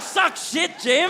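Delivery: high-pitched mock jeer; jeering shout. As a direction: crow it like a gleeful taunt. Friend gleefully jeering, acted out.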